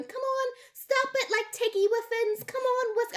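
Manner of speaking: high coaxing voice calling a cat. Retold or imitated. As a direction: sweet-talk the cat off, high up. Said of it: "the bride's pulling going".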